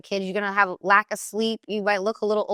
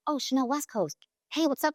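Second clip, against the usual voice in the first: a light, higher eager fan voice; 6 semitones higher, 5 dB quieter than usual. Retold or imitated. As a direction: play an excited fan spotting a star, only lightly voiced. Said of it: "some girl's like".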